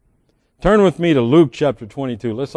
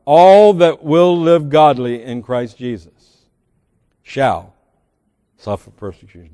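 preaching; 7 dB louder than usual. Acted. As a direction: repeat the verse so it sinks in. Repeats the verse.

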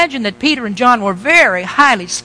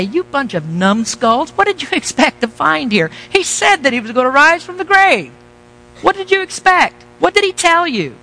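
high, exasperated pretend scolding, pitch climbing; mock scolding angel; 5 semitones higher than usual. Preacher imagines the angel scolding the women at the tomb, mock exasperated. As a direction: berate them like dim students, tongue in cheek.

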